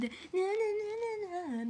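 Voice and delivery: high, drawn-out na-na imitation; mocking TikTok poser. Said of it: "t'es là en mode". (imitation moqueuse, aiguë et traînante)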